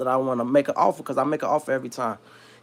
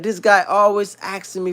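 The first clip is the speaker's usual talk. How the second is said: higher-pitched attempt at a Yiddish-Italian accent; 6 semitones higher than usual. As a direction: imitate the boss, Yiddish mixed with Italian. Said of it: "almost like mixed with Italian".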